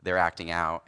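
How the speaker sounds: low, soft, worn-out mock sigh of a tired colleague; exhausted. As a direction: play him as drained and spent. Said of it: "He's like".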